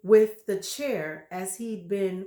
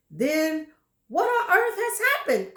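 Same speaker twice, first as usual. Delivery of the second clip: higher, louder worried wife; Missus Twit crying out; 8 semitones higher, 5 dB louder than usual. Acted. Wife alarmed at her stick, higher cry. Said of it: "cried Missus Twit".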